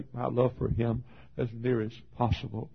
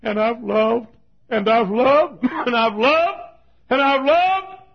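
grieving son; 14 semitones higher, 9 dB louder, slower than usual. Retold or imitated. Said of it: "He said".

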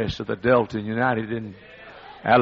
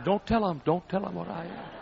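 hushed plea; 6 semitones higher, 8 dB quieter than usual. Retold or imitated. Preacher begging her to hush.

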